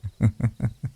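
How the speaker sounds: brief amused, taunting laugh; mocking chuckle. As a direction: chuckle at a lover's failed attack. Amused by failed arrow attack.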